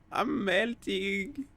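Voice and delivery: slow, sagging "I'm melting" with each word stretched long; drawn-out melting. Acted. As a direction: let "I'm melting" ooze out slowly like you're dissolving. Streamer picks melting option.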